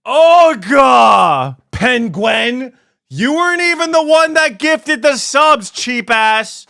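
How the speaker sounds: high, loud outburst at a viewer; yelling. Real, not acted. Streamer calling out a chatter over gifted subs, yelling. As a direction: holler it in exasperation. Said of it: "Stop yelling".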